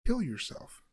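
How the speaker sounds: intrusive inner voice. Retold or imitated. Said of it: "my brain goes".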